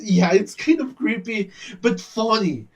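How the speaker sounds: ordinary talk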